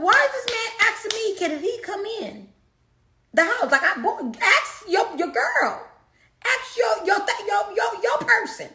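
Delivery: high incredulous voice with laughter breaking in; half laughing, half outraged inner thought; exasperated disbelief. Retold or imitated.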